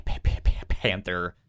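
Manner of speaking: ordinary talk, nothing marked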